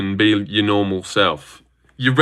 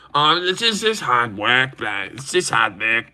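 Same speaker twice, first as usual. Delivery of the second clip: mocking put-on voice for an excuse; Gilly; 3 dB quieter than usual. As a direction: imitate a streamer's lame denial, trailing softer. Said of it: "you're so obviously buying subs, Gilly".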